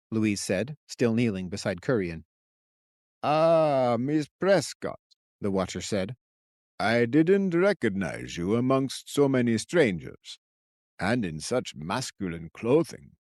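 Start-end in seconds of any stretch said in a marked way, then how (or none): 3.2-13.2 put-on old man voice.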